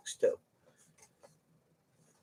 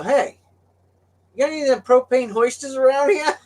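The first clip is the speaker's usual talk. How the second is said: high, loud breezy call; put-on voice; 13 semitones higher, 7 dB louder than usual. Trucker asking the dock guy for a forklift, high and breezy. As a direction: play it casual and cocky.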